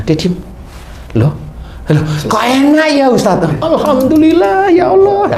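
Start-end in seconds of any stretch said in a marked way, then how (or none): 1.1-3.6 surprised. Retold or imitated. Patient suddenly feels relief. 3.6-5.4 high, slow, louder cry of thanks; joyful praise. Retold or imitated. Grateful outburst at sudden relief.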